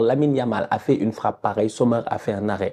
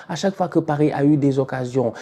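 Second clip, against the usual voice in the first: ordinary talk, nothing marked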